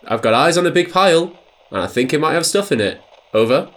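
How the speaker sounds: fast retelling of the message; over cb radio. Retold or imitated. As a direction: rattle the message off quickly. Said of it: "the rest of the message was".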